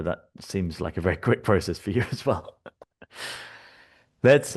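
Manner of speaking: ordinary talk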